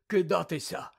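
dazed slow mumble, foreign voice; slurred, still groggy; dazed Krum mumbling after waking. Acted. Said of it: "пробелькотів Крум".

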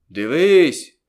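sharp rise in a loud, high call; loud shout. A narrator's reading. Conjurer commanding the crowd to look, loud shout. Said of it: "Тоді як гукнув".

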